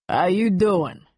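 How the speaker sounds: New York guy's casual pickup voice; New York-accented come-on; thick New York accent. A narrator's reading. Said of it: "in a thick New York accent".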